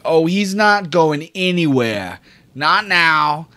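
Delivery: high loud threatening Dell; grim threat. Acted. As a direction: promise he won't escape. GM voices Dell.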